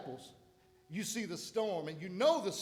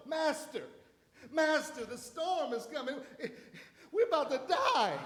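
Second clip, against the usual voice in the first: panicked disciples; 7 semitones higher than usual. Retold or imitated. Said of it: "disciples voiced crying out".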